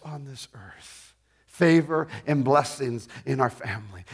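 low, softened prayer voice; earnest prayer. Acted. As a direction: pray it quietly, declaring each blessing to God.

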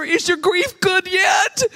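high, drawn-out mock wail; mock wailing question. Retelling her question to her sister at a funeral, mock wailing. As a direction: stretch the question out high, almost laughing.

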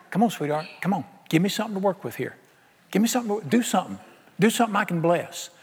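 soft, affectionate coaxing; God gently urging a loved one; gentle coaxing. Acted. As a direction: coax tenderly, keeping the volume down. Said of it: "Do you hear an angry deity".

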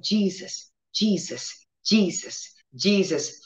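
softly repeating Jesus, Jesus; barely above a breath, calling the name; murmured name. Retold or imitated. In pain, quietly calling on Jesus. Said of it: "I was just saying".

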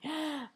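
soft startled gasp. Acted. Trader watching the stock, quiet mock gasp. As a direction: catch your breath in mock shock. Acting shock as stock rises.

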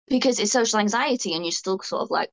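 ordinary talk

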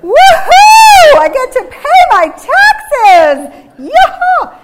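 over-the-top cheer, drawn out and very loud; joyful, exuberant. Pretend. Preacher imagining someone thrilled to pay taxes, exuberant whooping. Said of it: "with a joyful, exuberant".

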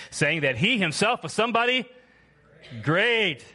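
loud, high preaching of scripture; preaching at full force. Acted. Preacher reading Acts to the church with full force. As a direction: proclaim it to the back row.

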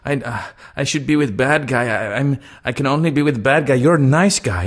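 high put-on voice, protesting; imitated Russian woman. Retelling why his date turned him down, imitated protesting Russian woman.

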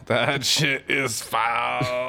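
slow, laughing repeat; laughing, drawn out. Real, not acted. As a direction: stretch it out through a laugh. Repeats the same line twice.

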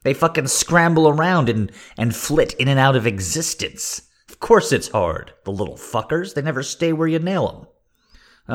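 mock rant. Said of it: "I'm like".

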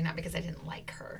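whispered aside, voice dropped right down. Real, not acted. Host whispering a teasing aside to viewers. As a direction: confide it under the breath.

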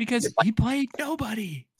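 very high-pitched rebuttal; exasperated protest. Real, not acted. Fan dismissing friend's praise of coach, high protest. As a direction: argue back, voice shooting way up. Heated argument over coach's record.

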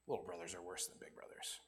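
quick conspiratorial whisper; whispered aside. Real, not acted. Preacher jokes to the congregation in a quick whisper. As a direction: toss it off under your breath as a joke.

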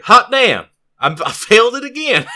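imitating an opponent's loud, high yell; excited yell. Opponent failing his charge again, a loud high imitated yell. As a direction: belt it out high like a player who just blew a roll. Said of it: "connoisseur of sounds that people make".

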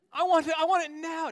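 loud, very high bratty outburst; mock spoiled-child tantrum; Veruca Salt. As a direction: demand it like a squealing brat. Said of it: "Willy Wonka with Veruca Salt".

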